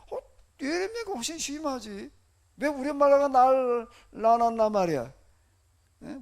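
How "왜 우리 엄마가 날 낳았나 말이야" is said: loud, protesting mock lament. Preacher voicing Jeremiah cursing his birth, loud lament. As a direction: wail the complaint out loudly, as a retelling. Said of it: "이렇게 탄식을 하고 앉았는 거예요".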